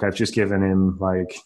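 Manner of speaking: ordinary talk, nothing marked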